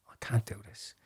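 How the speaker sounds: breathless whisper, almost no voice; whispered plea. Retold or imitated. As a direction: whisper it like a last desperate prayer. Desperate prayer in crisis.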